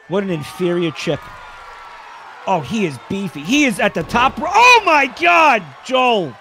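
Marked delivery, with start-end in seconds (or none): 3.4-6.3 excited play-by-play. Pretend. Commentator reacting to Joel under attack, excited shout. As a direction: voice leaps high and loud on the move.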